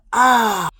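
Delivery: exasperated outburst; frustrated groan. Real, not acted. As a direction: let out a loud, frustrated "ah". Venting frustration after "oh my gosh.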